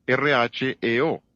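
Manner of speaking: R H E O spelled letter by letter, slowly; spelling out letters. Shown as a demonstration. Preacher spelling the Greek word for his congregation, slow and deliberate. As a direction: spell R H E O out one letter at a time. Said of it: "Es la palabra griega reo".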